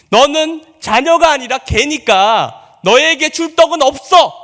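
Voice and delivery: thundering, cold rejection shouted down at a beggar; harsh firm refusal. Acted. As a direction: slam the door on her, shouting. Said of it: "이런 단호한 말을 해야 할까요".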